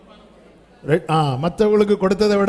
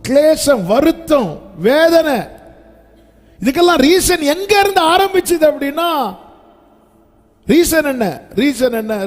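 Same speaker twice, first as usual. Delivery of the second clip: higher, louder preaching cry; impassioned preaching; 8 semitones higher, 4 dB louder than usual. Acted. Pastor lamenting Hannah's burdens, high and loud.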